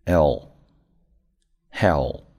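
hell with the final L held and stressed; hell, tongue up for a long final L; clear final L. Shown as a demonstration. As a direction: land the dark L at the end of hell. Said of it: "注意末尾音L的发音".

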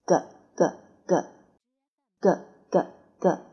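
咯咯咯 as a voiced back-tongue g; phonics g sound. Shown as a demonstration. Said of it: "我们一起来做一次".

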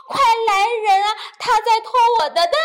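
high, loud cry building in urgency; alarmed hen shout. A narrator's reading. Hen accuses puppy of stealing eggs, alarmed shouting. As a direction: shout for help, pitch climbing.

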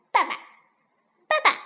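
tiny, high, chirping voice; duckling calling daddy. Acted. Newborn duckling calls to the fox, high eager chirping. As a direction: call out like an eager baby duck.

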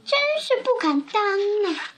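put-on high, quick, modest old woman; bashful old woman. A narrator's reading. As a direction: decline the honour in a high flutter. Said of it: "瘦小的老妇人不好意思地说".

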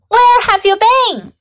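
high-pitched mild storybook yell; mother's yell. A narrator's reading. Mom calls her child home, high-pitched mock yell. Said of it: "yelled Mom".

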